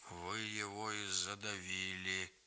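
low, hollow and slow; muffled, dull voice. A narrator's reading. Grigory blaming the uncles, muffled and low. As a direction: say it dully, under your breath. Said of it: "глухо сказал Григорий".

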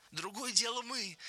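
eager, happy high voice; joyful. A narrator's reading. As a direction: jump in joyfully, pitch lifted high. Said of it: "радостно подхватил Леня".

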